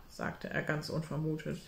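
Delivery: ordinary delivery, nothing marked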